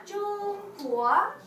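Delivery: 中国 drawn out slowly, tone by tone; slow model word. Shown as a demonstration. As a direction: say 中国 very slowly, level then rising. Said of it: "show me how to read".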